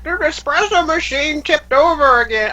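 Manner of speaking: a flustered grandmother crying out about the spilled machine; old granny voice. Acted. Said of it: "Gram Gram's like".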